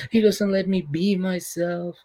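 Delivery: slow, drawn-out whimpering complaint; mock tearful whine. Wife pours out grievances to big brother, mock tearful whining. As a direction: imitate a sobbing wife, sighing between complaints.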